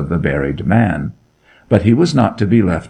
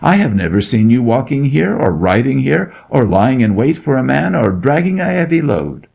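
higher and fast, tumbling denials; terrified denial; 6 semitones higher than usual. Acted. Kneeling boy denies everything to the Duke, fast and terrified. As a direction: babble out every denial in fear.

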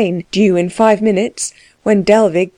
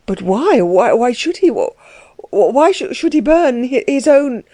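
rising, broken stammer, voice climbing higher; stammering in agitation; 5 semitones higher than usual. A narrator's reading. The young vicar stammers to Dellwig, rising in panic. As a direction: stumble over every word in panic. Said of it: "stammered Klutz in dreadful agitation".

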